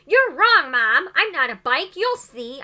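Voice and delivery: loud, high yell of defiance; a girl shouting at her doubting mother. A narrator's reading. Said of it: "I yelled".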